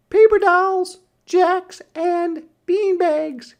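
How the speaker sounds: very high and loud, "Paper dolls, jacks, and beanbags" listed with relish; little sister's terms. A narrator's reading. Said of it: "she answered".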